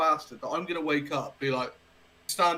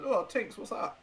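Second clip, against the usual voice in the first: talking to the dog; 8 semitones higher, slower than usual. Retold or imitated. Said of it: "I'd be like".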